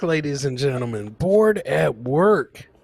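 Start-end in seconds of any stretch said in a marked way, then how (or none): 1.1-2.7 slow drawn-out announcer read of the title. Acted. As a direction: stretch each word out slowly, like a show title.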